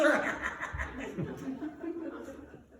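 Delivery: high wicked cackle. Acted. Charwoman laughing over her plunder, wicked cackle. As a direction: cackle like a thieving hag. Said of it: "burst into a wicked laugh".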